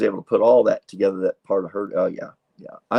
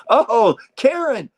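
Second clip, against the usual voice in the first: high, drawn-out happy greeting; excited recognition; 11 semitones higher, 5 dB louder, slower than usual. Real, not acted. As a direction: call out her name in delighted surprise. Spots a friend in the comments.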